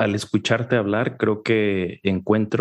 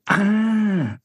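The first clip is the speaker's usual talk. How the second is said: realization; 11 semitones higher than usual. Retold or imitated.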